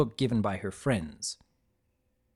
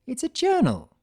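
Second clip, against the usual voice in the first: high, cheerful insistence; chirpy defensive cheer; bright; 12 semitones higher than usual. A narrator's reading. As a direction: sell the gift brightly. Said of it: "Rarity said brightly".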